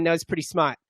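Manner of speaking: usual speaking voice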